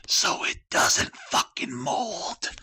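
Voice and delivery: sudden high, loud, slow punchline; hammed-up reveal shout; punchline burst. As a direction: blurt the secret out as a big payoff. Punchline after whispered buildup.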